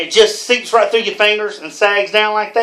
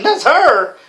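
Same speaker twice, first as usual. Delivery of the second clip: higher, mildly startled echo; retold disbelief; 6 semitones higher than usual. Friend retelling his reaction to the pictures, mock disbelief. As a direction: repeat it in mock disbelief, pitched up.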